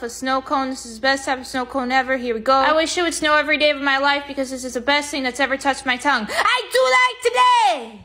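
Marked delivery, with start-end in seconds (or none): 6.3-8.0 excited shout. Acted. Delighted by snow cone.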